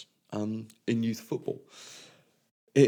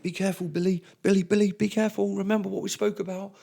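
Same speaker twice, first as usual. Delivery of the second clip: higher, louder call across the pitch to Billy; calling out warning; 11 semitones higher, 6 dB louder, faster than usual. Retold or imitated. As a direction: warn the boy from a distance. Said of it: "be like".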